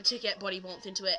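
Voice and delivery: usual speaking voice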